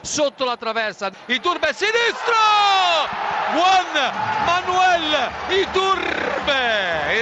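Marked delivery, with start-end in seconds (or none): 1.3-7.2 high, very drawn-out scorer's name; breathless italian goal commentary. Real, not acted. Torino equaliser call. (nome del marcatore, alto e allungatissimo)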